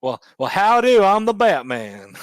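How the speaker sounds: loud, high country howdy; hammed-up cowboy country Batman; country accent. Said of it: "Very country like".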